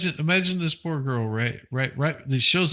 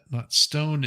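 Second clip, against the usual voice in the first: usual speaking voice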